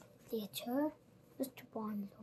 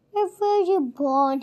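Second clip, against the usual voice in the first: urgent, louder, higher question; urgently; 5 semitones higher, 13 dB louder than usual. A narrator's reading. Brownlow suddenly asks Oliver his birthplace, urgent and louder. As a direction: press the boy for an answer. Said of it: "he asked urgently".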